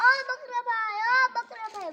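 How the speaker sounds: loud high shout; calling out. Acted. Rabbit shouts again to the goat, loud high call. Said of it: "પાછો બૂમ પાડી".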